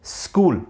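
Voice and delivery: school begins straight on s, no vowel before it; correct pronunciation. Shown as a demonstration. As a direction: start school right on the s. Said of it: "it is pronounced as".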